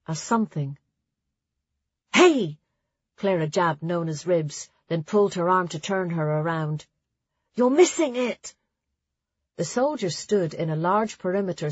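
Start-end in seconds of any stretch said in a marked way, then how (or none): 2.1-2.6 high, loud call for attention; urgent call. A narrator's reading. Clara jabbing Nona to get her attention, urgent call. Friend calls to distracted girl. 7.5-8.5 high-pitched, insistent nudge; urgent urging. A narrator's reading. Clara turning Nona round to watch, urgent urging. Friend urges girl to look.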